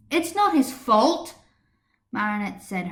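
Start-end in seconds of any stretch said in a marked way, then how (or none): 0.1-1.3 higher and louder, defending him hotly; rising voice. A narrator's reading. Marinette defending Chat Noir in class debate, voice rising. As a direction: start to push back hard. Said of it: "her voice starting to rise".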